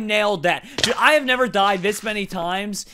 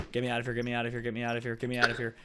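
scared muttering; 7 semitones lower, 12 dB quieter, much faster than usual. Real, not acted. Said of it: "now I'm terrified for my life".

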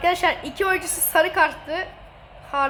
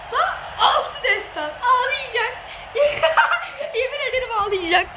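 high, laughing gloat; laughing taunt; 7 semitones higher than usual. Real, not acted. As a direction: giggle while rubbing in the sixth goal. Teasing losing friend, laughing. (altıncı golde gülerek alay)

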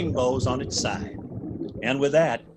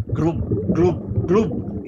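bubbly gloop sounds; much slower than usual. A narrator's reading. Underwater sound effect.

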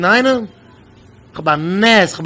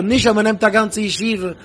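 ordinary delivery

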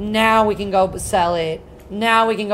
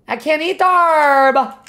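exasperated protest; 5 semitones higher, 3 dB louder than usual. Real, not acted. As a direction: repeat it in rising disbelief. Frustrated at game item, repeated.

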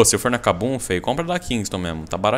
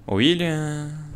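drawn-out, higher call across the room; 6 semitones higher, much slower than usual. Real, not acted. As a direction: stretch the name out to get his attention. Calling out to a teammate.

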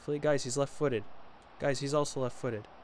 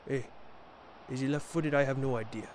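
usual speaking voice

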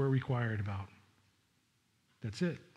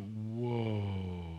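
hushed awe; 5 semitones lower, 5 dB quieter than usual. Pretend. Imagined awe at prophecy.

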